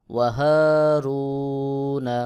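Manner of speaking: ওয়াহারুনা slowly chanted like a recitation; Quran recitation. Shown as a demonstration. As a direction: intone ওয়াহারুনা in a long recitation tone.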